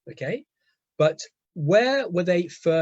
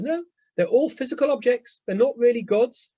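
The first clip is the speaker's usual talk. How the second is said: high, insistent correction; emphatic rebuttal; 9 semitones higher, 4 dB louder than usual. Acted. Genesis answering magical mythology, emphatic rebuttal. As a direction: refute it firmly, pitched up high.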